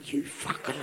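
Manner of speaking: hushed, voiceless curse under the breath; secret cursing. Acted. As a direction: curse him so he cannot hear. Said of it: "behind his head, through the wall".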